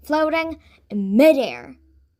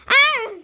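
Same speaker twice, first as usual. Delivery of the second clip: very high, loud scream; 14 semitones higher, 8 dB louder than usual. A narrator's reading. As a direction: shriek in terror. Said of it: "Sungha and Jane screamed".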